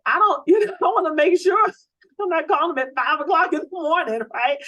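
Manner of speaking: loud, high, laughing rush; excited laughing burst. Real, not acted. Trainer laughing to her team about early-morning calls, loud and excited. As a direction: blurt it out through a laugh.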